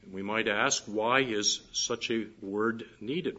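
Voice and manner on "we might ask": ordinary delivery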